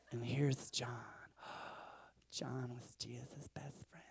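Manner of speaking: hushed, breathy storytelling whisper; a teacher whispering a secret to kids; Sunday school teacher. Retold or imitated. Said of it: "my Sunday school teacher, she's like".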